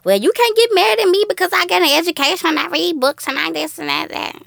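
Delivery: high, put-on superior voice; imitating someone who thinks they're better; mock uppity educated person. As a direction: boast of schooling in a high mocking voice. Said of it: "that's how it always goes into".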